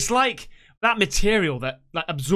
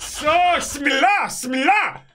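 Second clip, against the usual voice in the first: echoing the scared man; 11 semitones higher, 4 dB louder than usual. Retold or imitated.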